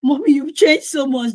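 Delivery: high, loud delighted-child imitation; daughter's voice. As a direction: imitate a daughter exclaiming to mom. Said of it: "And then she goes".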